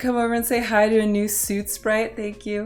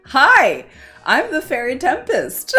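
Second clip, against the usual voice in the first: high, giddy hello breaking into laughter; flustered introduction; 10 semitones higher, 6 dB louder than usual. Real, not acted. Introducing herself to surprise raiders, flustered and giddy. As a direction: burst out with a loud hi, then laugh. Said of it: "I'm flustered".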